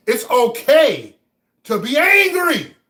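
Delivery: slow, high, loud outburst; real anger at full voice; angry shout. Real, not acted. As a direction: shout it with drawn-out words. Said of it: "I'm angry".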